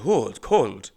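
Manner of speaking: lightly imitated old Russian lady; Russian accent. Old Russian passenger complains to driver, imitated Russian accent. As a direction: mimic a thick Russian accent. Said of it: "Very thick accent".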